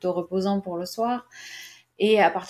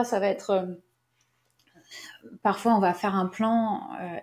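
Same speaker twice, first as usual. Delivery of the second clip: ordinary talk, nothing marked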